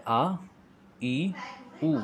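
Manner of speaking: आ, ई, ऊ each said slowly and separately; vowels sounded out. Shown as a demonstration. Teacher drills the class on आ, ई, ऊ. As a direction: sound out the three short vowels one by one. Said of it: "आप देखिए".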